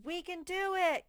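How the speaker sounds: put-on children cheering as a team. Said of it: "They're saying".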